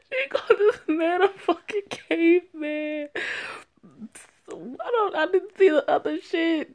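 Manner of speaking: real thrilled shock; delighted disbelief. Real, not acted. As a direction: squeal with glee at the insult. Excited reaction laughing.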